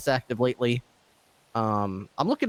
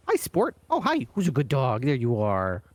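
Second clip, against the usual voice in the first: talking to a dog. Real, not acted. Cooing at a stray dog.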